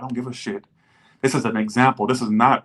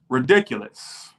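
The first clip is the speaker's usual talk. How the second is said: ordinary talk, nothing marked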